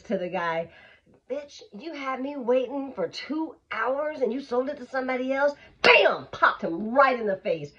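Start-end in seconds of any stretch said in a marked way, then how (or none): 1.3-5.5 mock furious buyer, pitched up; mad buyer. Angry buyer to the seller, mock furious. 5.8-6.3 punch sound. Retold or imitated. Mimicking a punch.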